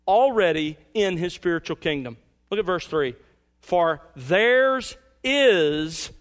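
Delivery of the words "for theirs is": "is" drawn out very slowly and pressed hard; stress on is. Shown as a demonstration. Preacher to congregation, slow weighty stress on "is". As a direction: lean slowly on "is" to set present against future. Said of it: "not shall be".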